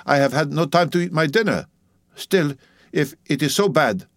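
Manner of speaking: put-on Dutch-accented grumbling, slowing at the end; Dutch midwife, grudging.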